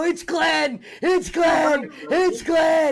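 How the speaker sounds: high, shouted announcer call; wrestling commentator. Pretend. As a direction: yell the reveal like the commentator. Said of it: "yeah, Jericho".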